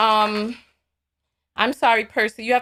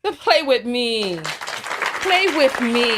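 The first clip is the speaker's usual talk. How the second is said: slow taunt; slower than usual. Real, not acted. Taunting the racist callers.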